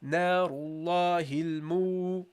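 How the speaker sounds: narullahil mu, long drawn mu; teacher prolonging mu; slow recitation. Shown as a demonstration. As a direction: hold the mu long. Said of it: "prolong by the waw".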